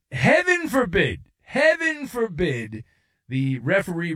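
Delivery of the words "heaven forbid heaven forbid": mock horror. Real, not acted.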